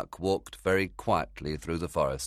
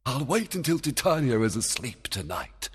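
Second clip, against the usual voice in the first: fairy king voice; 7 semitones higher, 3 dB louder than usual. Acted. Oberon thinking aloud.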